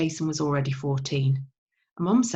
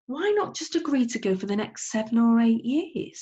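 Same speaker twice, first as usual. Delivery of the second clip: Dad suggesting; 6 semitones higher than usual. Acted. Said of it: "suggested Dad".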